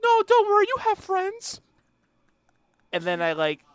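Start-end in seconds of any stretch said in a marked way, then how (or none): 0.0-1.6 put-on much higher and louder, sugary girl voice; mock sympathetic girl. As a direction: squeal it like a pitying girl on Tinder. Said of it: "any girl that's, like, sad enough to say".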